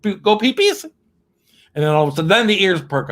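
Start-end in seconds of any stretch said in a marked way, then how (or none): 0.0-0.8 put-on high, sing-song baby talk to a dog. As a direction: coo at the dog like a pet owner. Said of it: "we go to the dogs".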